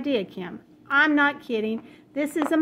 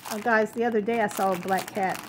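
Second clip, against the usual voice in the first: ordinary delivery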